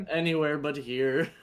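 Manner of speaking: ordinary talk, nothing marked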